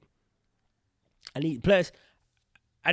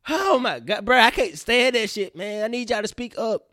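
loud, raised-pitch complaint; fed up, plead loudly for more volume; exasperated rant; 5 semitones higher, 8 dB louder, slower than usual. Real, not acted. Podcaster ranting about whispering actors, loud exasperation.

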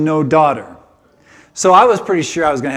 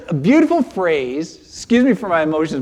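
normal talking voice

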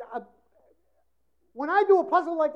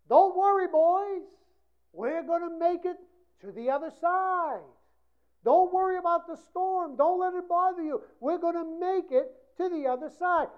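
reassuring Jesus; 5 semitones higher than usual. Acted. Jesus voiced reassuring disciples.

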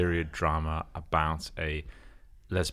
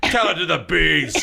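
mock judge's sentence; 9 semitones higher, 6 dB louder than usual. Acted sentencing in bee skit.

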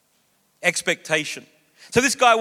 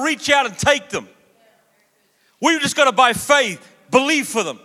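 higher and louder rising sermon; full-force preaching; 6 semitones higher, 5 dB louder than usual. Real, not acted. Pastor exhorts the church that miracles can happen, fervent preaching. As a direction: stir the congregation with fervour.

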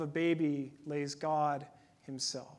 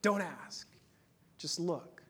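gentle hush; 6 dB quieter, slower than usual. Retold or imitated. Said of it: "just like my dog".

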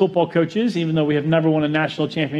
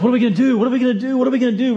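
higher, louder repeated panic cry; mock panic; 7 semitones higher, 3 dB louder, much faster than usual. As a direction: act out waking in a panic. Woke up, like, in a sweat.

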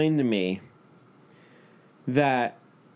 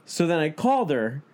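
ordinary talk, nothing marked

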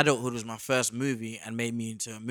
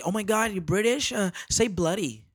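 put-on higher, excited American girl gush; imitating American girls; 8 semitones higher than usual. S0 mimics American girls meeting a Brit, high excited gush. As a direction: gush like a starstruck fan. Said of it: "these girls always say".

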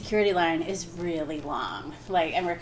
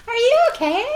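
much higher, louder sweet concern; high concerned stewardess; 7 dB louder than usual. Retold or imitated. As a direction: chirp it like a flight attendant checking in. Voicing stewardesses.

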